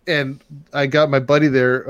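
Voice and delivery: ordinary delivery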